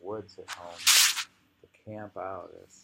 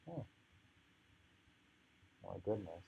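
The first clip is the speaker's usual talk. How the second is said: ordinary talk, nothing marked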